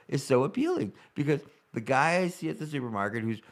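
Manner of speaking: normal talking voice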